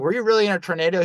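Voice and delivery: high, fast, amazed disbelief; incredulous friend checking the tornado story; surprised question. Retold or imitated. Asking if tornado was real.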